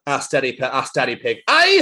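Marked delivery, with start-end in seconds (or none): 1.5-1.8 high, loud Scottish Peppa. Acted. Friend reads Scottish Peppa book, loud high Peppa. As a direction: chirp it like a little Scots girl. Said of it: "I'm gonna do it in my accent".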